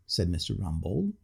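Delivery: ordinary delivery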